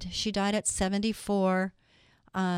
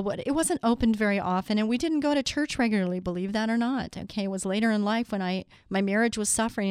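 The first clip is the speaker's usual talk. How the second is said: normal talking voice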